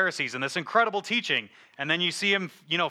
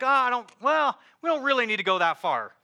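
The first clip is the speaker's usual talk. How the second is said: higher, mildly mocking waffle; mock hedging; 7 semitones higher than usual. Pastor mimicking a lukewarm churchgoer, mild mock hedging. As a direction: lightly parody someone softening the truth.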